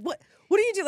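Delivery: high, loud alarmed what are you doing; startled shout. Retold or imitated. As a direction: yell it at strangers in your car. Boyfriend startled by car prank.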